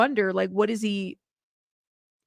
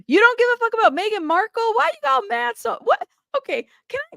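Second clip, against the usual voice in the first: much higher, disbelieving questions; incredulous surprise; 12 semitones higher, 3 dB louder than usual. Real, not acted. Streamer stunned by chat's Meghan Markle takes, incredulous. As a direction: shoot up in pitch, amazed at the chat. Reacting to chat about Meghan.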